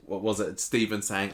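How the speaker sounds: normal talking voice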